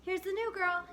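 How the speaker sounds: loudly announcing, voice up high; loud announcement. A narrator's reading. Boy presenting the new girl to the teacher, loudly. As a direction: proclaim it to the whole room. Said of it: "he announced so loudly".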